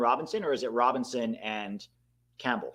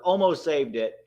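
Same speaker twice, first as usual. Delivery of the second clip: normal talking voice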